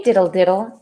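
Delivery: usual speaking voice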